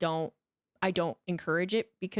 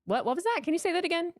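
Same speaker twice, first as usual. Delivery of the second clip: feigned confusion; 9 semitones higher, faster than usual. Shown as a demonstration. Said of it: "just no sell it and be like".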